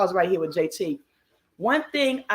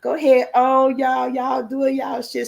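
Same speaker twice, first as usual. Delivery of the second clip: higher and louder, hyping them up, then slowing; proud big-sister cheer; 6 semitones higher, 4 dB louder than usual. Retold or imitated. Little sister cheering on the first-time vloggers, loud proud hype. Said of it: "looking back like".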